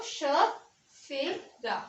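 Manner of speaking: "सफेरा" spoken slowly, syllable by syllable; slow word demo. Shown as a demonstration. Teacher slowly sounding out सफेरा to show tongue position. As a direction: stretch "सफेरा" out so every sound is clear. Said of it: "जैसे कि सफेरा".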